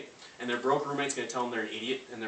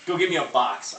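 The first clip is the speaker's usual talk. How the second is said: higher and louder, brusque order; mock demand; 6 semitones higher, 10 dB louder than usual. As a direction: bark for a to-go box. Customer continues refusal.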